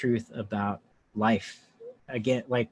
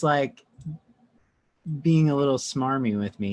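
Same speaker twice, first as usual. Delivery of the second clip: usual speaking voice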